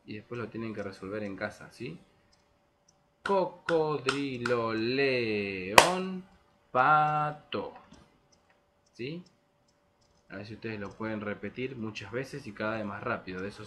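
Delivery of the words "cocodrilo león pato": Cocodrilo, león, pato beaten out loudly in syllables; syllable rhythm. Shown as a demonstration. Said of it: "se los voy a hacer una vez".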